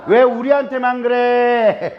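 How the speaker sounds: mock hurt Jeolla complaint.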